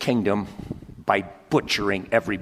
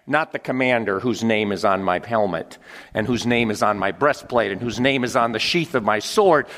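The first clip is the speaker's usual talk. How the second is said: normal talking voice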